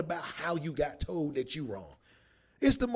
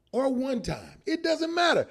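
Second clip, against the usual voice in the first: ordinary talk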